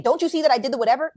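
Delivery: loud, high, fast complaint; demanding man. Retold or imitated. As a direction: snap the question at her, fast and loud. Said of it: "when you say".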